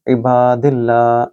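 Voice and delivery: इबादिल्ला with a thin, light ल. Shown as a demonstration. Teacher showing the light l. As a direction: keep the ल of इबादिल्ला light, slowly. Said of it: "इसको आप दिल्ला मोटा नहीं पढ़ सकते".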